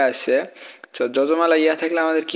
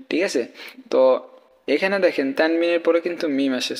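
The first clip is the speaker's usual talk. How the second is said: ordinary delivery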